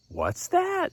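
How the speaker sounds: high-pitched, puzzled little question; curious fish. Acted. Fish spotting a light in the dark, curious high voice. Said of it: "the fish would see that light and go".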